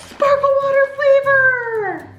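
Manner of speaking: high, stretched delighted cry; gushing surprise, pitched well up; thrilled reveal. Real, not acted. Reveals present to child.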